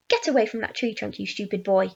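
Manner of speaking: quick, understated scolding; scolding yell. A narrator's reading. Aunt ordering boy from the tree, mild cross yell. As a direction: tell him off briskly. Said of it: "Aunt Spiker yelled".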